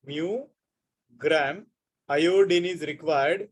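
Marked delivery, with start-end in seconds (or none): 0.1-1.6 MU and GRAM set apart by a long pause; word by word. Shown as a demonstration. Teacher repeats the unit for students to catch, word by word. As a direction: dictate mu, wait, then gram.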